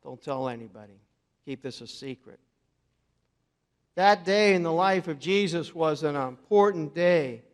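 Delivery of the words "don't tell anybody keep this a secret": hushed. Retold or imitated. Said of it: "he tells everyone to be quiet".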